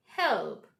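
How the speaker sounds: help with a rolled L, falling; correct dark L. Shown as a demonstration. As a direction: stress help and linger on its L. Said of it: "The correct pronunciation of this".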